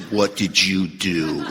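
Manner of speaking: low, slow, pointed question; confronting sponsor. Retold or imitated. Sponsor questioning an angry sponsee, slow low challenge. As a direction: turn the blame back, imitating a sponsor, low and deliberate. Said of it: "My sponsor never says that".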